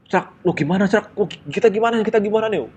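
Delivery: mild panicky pleading; panicked asking. Retold or imitated. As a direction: friend panicking about the conductor. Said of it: "Panik gua bertiga tuh".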